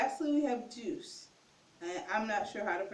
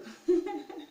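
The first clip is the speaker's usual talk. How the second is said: high, louder burst of giggles; amused laugh; 7 semitones higher, 5 dB louder than usual. Real, not acted. Teacher tickled by cuckoo likeness, high giggle. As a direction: giggle brightly at the funny word.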